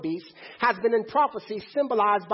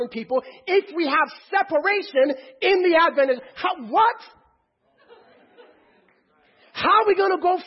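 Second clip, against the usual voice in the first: louder and higher, pressing each question home; fired-up preaching; 5 semitones higher, 4 dB louder than usual. Acted. Preacher challenging the congregation about segregation, fired-up preaching.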